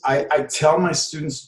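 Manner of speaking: ordinary talk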